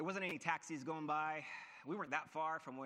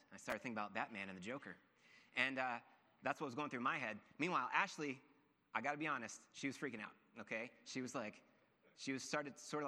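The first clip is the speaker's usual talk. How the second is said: ordinary talk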